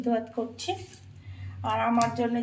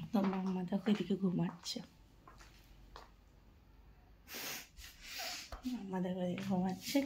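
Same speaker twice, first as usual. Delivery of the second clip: hushed; 5 semitones lower, 12 dB quieter than usual. Real, not acted. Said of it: "একটু আস্তে আস্তে কথা বলতে হবে". (ঘুমন্ত বাচ্চার পাশে নিচু গলায়)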